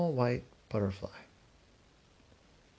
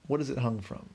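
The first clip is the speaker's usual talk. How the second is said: plain reading voice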